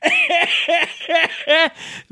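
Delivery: real high-pitched giggle at own flub. Real, not acted. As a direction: break up laughing, high. Laughing at own repeated flub.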